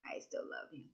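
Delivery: breathy hushed reassurance; tender whisper. Retold or imitated. Whispering love to herself after being late. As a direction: whisper it to yourself lovingly. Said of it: "Even if I'm sometimes chaotic".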